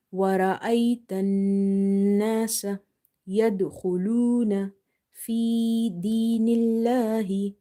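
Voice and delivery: measured, level-toned recitation with long held vowels; Quran recitation demo. Shown as a demonstration. Teacher reciting the verse for learners, measured recitation. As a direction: recite evenly, stretching the long sounds.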